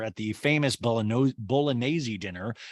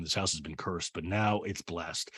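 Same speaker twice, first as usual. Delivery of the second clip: low, quiet mimicry; Harry Hamlin impression; 7 semitones lower, 7 dB quieter than usual. Harry joking about his house, low soft voice. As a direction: drop low and soft, slowing at the end. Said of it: "He is like".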